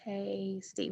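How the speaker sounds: "Tasty" said softly, drawn out in two beats; two syllables. Shown as a demonstration. Teacher counting syllables in the word tasty, softly drawn out. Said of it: "We have two syllables".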